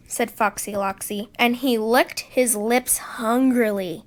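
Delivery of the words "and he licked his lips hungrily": plain reading voice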